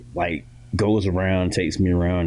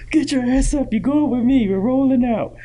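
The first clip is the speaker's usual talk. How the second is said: high, loud bossy dad voice; drunk dad ordering; 4 dB louder than usual. Retold or imitated. Drunk dad dragging his son out to a club, high and loud. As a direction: command it like a rowdy father. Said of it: "He's like".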